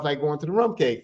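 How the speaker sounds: ordinary delivery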